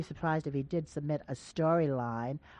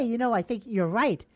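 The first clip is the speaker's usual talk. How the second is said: ordinary talk, nothing marked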